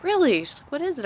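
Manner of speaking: high-pitched, intrigued retold reaction; surprised, high questioning; eager curiosity. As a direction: jump up high, imitating a keenly interested listener. Acts out woman's curious reply.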